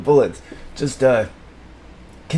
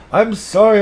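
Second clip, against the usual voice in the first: ordinary talk, nothing marked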